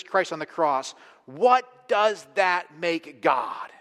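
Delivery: slow and forceful, each phrase pressed home; emphatic, drawn out. Real, not acted. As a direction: preach it slowly, hammering each word. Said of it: "I want to say this emphatically".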